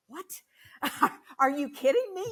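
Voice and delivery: high, loud incredulous reaction; retold disbelief. Mother retelling her reaction to daughter's surgery news, incredulous. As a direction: replay a shocked outburst, pitch jumping up.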